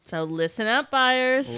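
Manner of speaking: hey-everyone call to attention; playful call-out. Pretend. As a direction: call the buyers to attention, punching the last word loud. Calling buyers to attention.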